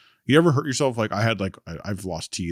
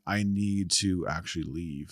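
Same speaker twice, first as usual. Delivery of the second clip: quiet resigned; 7 dB quieter, slower than usual. Retold or imitated. Resigned inner decision.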